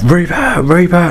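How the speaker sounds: high calling out of the name; calling the dog. Retold or imitated. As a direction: call the dog's name urgently. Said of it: "I was calling him".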